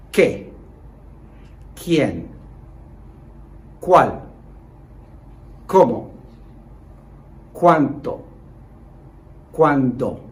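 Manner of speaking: each question word said slowly and loud, falling; clear model pronunciation. Shown as a demonstration. Teacher to students repeating after him, slow loud model words. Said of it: "Please repeat after me".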